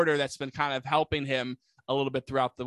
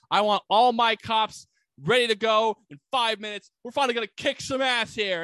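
raised, higher voice rallying the troops; mock rallying cry; 7 semitones higher, 5 dB louder than usual. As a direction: act out a chief firing up his men. Police chief rallies his cops.

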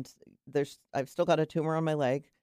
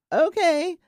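high, louder sing-out okay; bright acceptance; 13 semitones higher, 6 dB louder than usual. Acted. Swimmer accepting the name an animal gives, high cheery acceptance. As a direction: pitch it way up, cheerfully agreeing.

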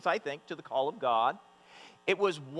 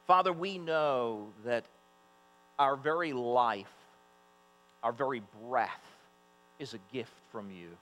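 prayer voice; 6 semitones lower than usual. Real, not acted. Opens in prayer.

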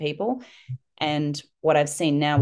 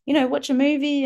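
breezy distraction offer; 10 semitones higher, faster than usual. Retold or imitated. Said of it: "people go".

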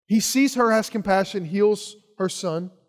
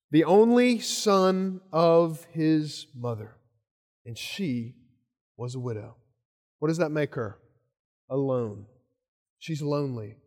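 hushed; 4 semitones lower, 5 dB quieter than usual. Real, not acted. Preacher drops voice for pathos.

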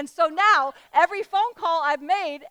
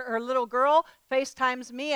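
ordinary talk, nothing marked

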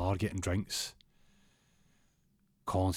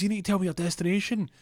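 fussy cabbie; 11 semitones higher, faster than usual. Retold or imitated. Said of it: "He goes".

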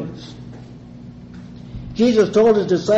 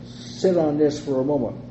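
ordinary talk, nothing marked

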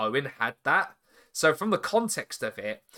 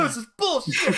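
mock complaint; 9 semitones higher than usual. Mocking a wrestler's complaint.

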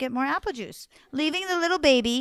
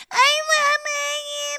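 put-on very high, slow whining toddler; baby refusing to use a big girl voice; drawn-out baby whine; 14 semitones higher, 6 dB louder, slower than usual. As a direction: stretch the whine out like a stubborn baby.

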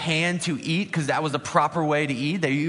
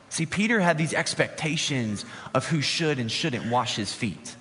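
ordinary talk, nothing marked

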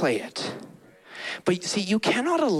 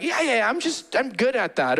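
shrugging off a gift; 6 semitones higher than usual. Acted. Voicing dismissive listener.

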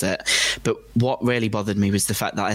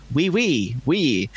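mock French accent; 3 semitones higher, much slower than usual. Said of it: "the person would just reply with".

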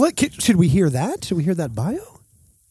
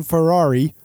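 usual speaking voice

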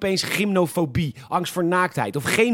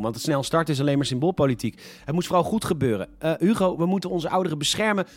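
usual speaking voice

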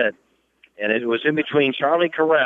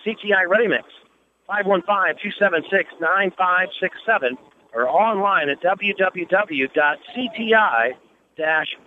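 ad read; 4 semitones higher than usual. Acted. Reads sponsor ad.

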